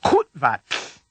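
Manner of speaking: Kutvat ending in a noisy t-plus-whispered-l; whispered final tl. Shown as a demonstration. Teacher points to the last sound, Kutvat ending in whispered tl. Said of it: "Listen particularly to the last sound".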